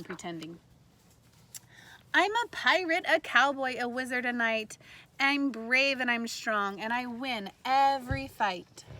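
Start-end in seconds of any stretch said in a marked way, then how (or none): 2.1-8.8 louder, proud read-aloud; bold story reading. A narrator's reading. Teacher reading to kindergartners, bold proud read-aloud. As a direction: boast it like a brave kid hero. Reads picture book to kindergartners.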